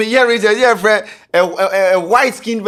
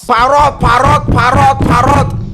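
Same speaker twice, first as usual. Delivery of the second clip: loud high repeated call of "parrot"; 8 semitones higher, 6 dB louder than usual. Retold or imitated. Owner calling his silent parrot loudly, trying to wake it. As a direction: call "parrot" over and over, louder each time.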